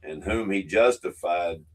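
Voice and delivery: loud, measured Bible reading with weight on each clause; solemn church reading, building and falling; scripture reading. Acted. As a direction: proclaim the verses like a Sunday reading. Reads scripture aloud.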